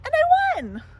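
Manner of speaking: high, loud gleeful shout; a kid bursting with victory; triumphant cheer. Acted. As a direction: crow about winning. Child brags winning again.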